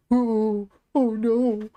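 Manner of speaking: ordinary talk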